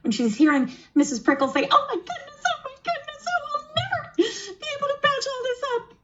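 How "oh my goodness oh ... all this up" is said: very high, soft, fretful hedgehog squeak; a tiny worn-out hedgehog fussing over her nest; little tired hedgehog voice. Acted. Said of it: "I'm using my little tired hedgehog voice".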